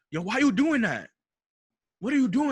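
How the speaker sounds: high, loud imitation of an angry cop; yelling officer. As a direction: shout it like a cop challenging a driver. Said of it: "yelling at me".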